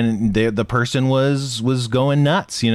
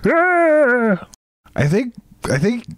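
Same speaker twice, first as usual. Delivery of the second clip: squeaky voice; 11 semitones higher, slower than usual. Acted. Replies in high voice.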